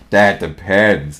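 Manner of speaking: slow, very low gloomy donkey; low, slow storybook donkey; Eeyore. A narrator's reading. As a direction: drag it out deep and glum. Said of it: "came Eeyore's voice".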